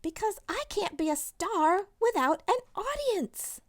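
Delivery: high whiny sulking complaint; dramatic sulk. Acted. Sulking girl complaining to her dad, high and whiny. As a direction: whine it like a sulking diva.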